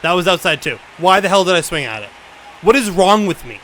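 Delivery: self-directed anger. Real, not acted. Angry at own swing.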